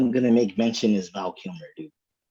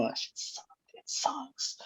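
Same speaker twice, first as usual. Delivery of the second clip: hushed, unvoiced aside; whispered aside; 14 dB quieter, slower than usual. Real, not acted. Telling his friends about the actor, whispered. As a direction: whisper it so the guest can't hear.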